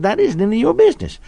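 high, quick retort in another man's voice; mock indignant. Church member rebuffing the pastor, mock indignant.

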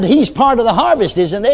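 high-pitched, pressing preacher's plea; insistent prayer. Retold or imitated. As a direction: press your case with the Lord. Presses his case in retold prayer.

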